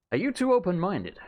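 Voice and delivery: ordinary delivery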